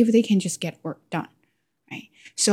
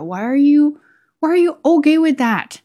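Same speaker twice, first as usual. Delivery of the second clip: high, louder exasperated challenge; getting mad; 8 semitones higher, 5 dB louder than usual. Retold or imitated. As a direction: demand it in mild, high-pitched indignation. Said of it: "Then I get mad".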